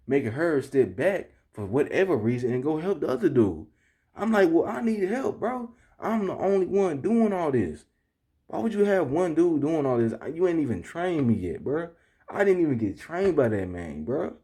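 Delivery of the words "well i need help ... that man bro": high-pitched, aggrieved complaint; acting out exasperation at being left alone; retold exasperated protest. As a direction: argue your case in raised pitch. Retelling his protest to the manager.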